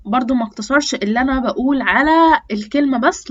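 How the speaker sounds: ordinary talk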